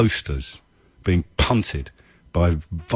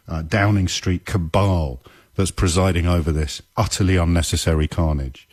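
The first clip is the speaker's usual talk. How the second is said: ordinary talk, nothing marked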